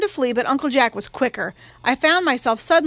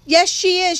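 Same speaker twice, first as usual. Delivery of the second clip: shouted; 6 semitones higher, 4 dB louder than usual. A narrator's reading. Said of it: "shouted Francis".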